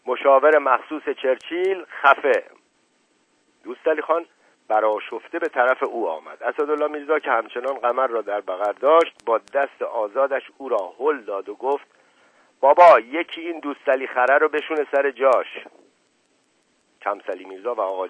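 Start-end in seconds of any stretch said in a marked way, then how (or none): 0.0-2.4 loud, raised put-down; forceful order to shut up; shouting. A narrator's reading. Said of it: "فریاد زد". 12.6-15.5 loud, raised appeal; irritated, high-pitched complaint; exasperated outburst. A narrator's reading. Pushes rival away, calls him donkey.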